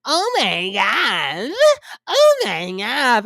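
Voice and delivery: very slow, swooping mock dismay; mimicking Carlos King. Host mimics the reunion host running around, drawn-out theatrical exclamation. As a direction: stretch every word into an exaggerated wail. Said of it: "Carlos King is running around the stage like".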